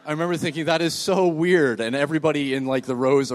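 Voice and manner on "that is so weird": slowed, drawn-out disbelief. Retold or imitated. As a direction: linger on the weirdness.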